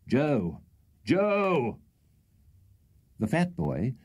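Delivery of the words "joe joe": calling Joe across the garden, high. Acted. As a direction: summon the boy from afar. Old gentleman calling the fat boy.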